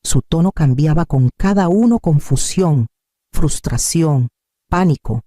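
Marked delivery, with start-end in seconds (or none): none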